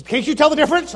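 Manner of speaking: mock incredulous question. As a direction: imitate someone amazed you can't hear it. Retold translator's incredulous question.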